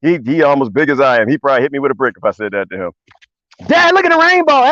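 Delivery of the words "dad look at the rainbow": put-on high-pitched excited boy calling Dad; imitating flipped-out kid. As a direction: mimic a son bursting with excitement. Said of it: "we were flipping out".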